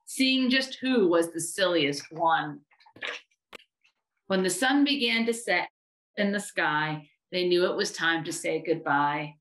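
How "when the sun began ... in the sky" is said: plain reading voice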